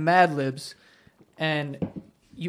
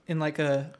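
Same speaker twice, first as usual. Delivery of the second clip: low-key, much softer delivery; calm voice; 3 semitones lower, 8 dB quieter, much slower than usual. Shown as a demonstration. Guest describing how he argues, soft calm voice. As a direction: drop the volume to sound calm. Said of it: "calm voice".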